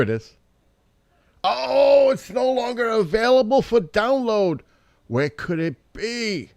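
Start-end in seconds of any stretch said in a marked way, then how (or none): 1.4-6.5 loud squeal of dismay, very high; high-pitched wail. Real, not acted. Finding the download gone, high-pitched wail. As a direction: wail it way up high, drawing out the ends. File search fails, mock wail.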